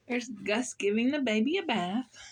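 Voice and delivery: ordinary delivery, nothing marked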